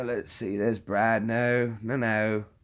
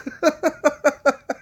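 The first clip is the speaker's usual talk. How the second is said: high-pitched burst of laughter; 4 dB louder than usual. Real, not acted. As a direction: cackle at the memory. Laughing at own story.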